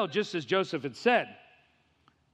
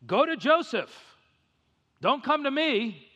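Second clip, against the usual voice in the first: higher, slow commanding king's voice; Pharaoh commanding; 7 semitones higher than usual. Retold or imitated. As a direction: hand it off like a king. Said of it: "here's what Pharaoh said".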